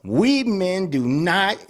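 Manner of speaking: usual speaking voice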